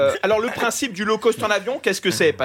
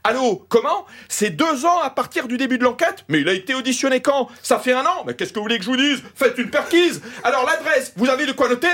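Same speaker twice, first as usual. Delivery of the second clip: higher-pitched, brisk indignant lawyer; problematic lawyer on phone; 7 semitones higher than usual. Acted. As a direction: play an exasperated lawyer firing questions into the phone. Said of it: "je l'ai appelé l'avocat problématique".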